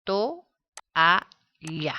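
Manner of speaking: Ma-es-tra said syllable by syllable; syllables split apart. Shown as a demonstration. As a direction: break Ma-es-tra into three separate beats. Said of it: "Si separamos". (separar Ma-es-tra sílaba por sílaba)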